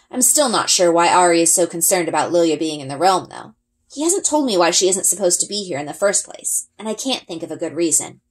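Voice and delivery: plain reading voice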